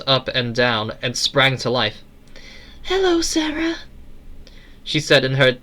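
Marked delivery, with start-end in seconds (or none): 2.8-3.8 tiny, very high-pitched falsetto; tinny little voice. A narrator's reading. Eleanor coming to life to greet Sarah, tinny little voice. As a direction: squeak it out in a tinny little girl voice. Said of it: "tinny little voice".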